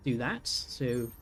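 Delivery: ordinary delivery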